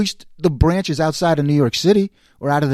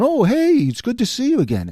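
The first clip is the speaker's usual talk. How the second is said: mock glad-hand greeting; 6 semitones higher than usual. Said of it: "trying to give me, like, the glad hand".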